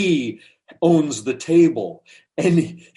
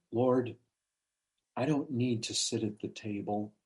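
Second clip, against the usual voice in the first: very soft, lowered humble voice; humble plea; 3 semitones lower, 11 dB quieter than usual. Retold or imitated. Woman humbly addresses the Lord, meek and hushed. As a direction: plead meekly and quietly. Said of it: "she's taken a humble position".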